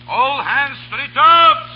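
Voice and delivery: high, slow bellowed ship's orders; ringing sea mate's shout; calling orders. Acted. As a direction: bellow across the deck to the crew. Mate calling orders to crew.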